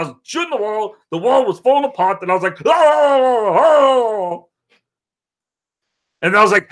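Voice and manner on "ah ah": excited ah yells. Retold or imitated. Reacts to the wall collapsing.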